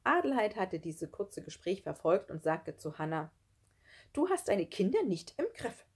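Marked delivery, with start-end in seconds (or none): 4.1-5.9 high-pitched reproach; reproachful. A narrator's reading. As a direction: reproach her with the pitch lifted. Adelheid reproaches Hannah in story. (vorwurfsvoll, mit hoher Stimme)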